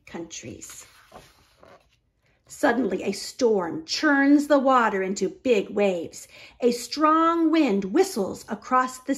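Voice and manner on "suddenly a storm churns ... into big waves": ordinary delivery, nothing marked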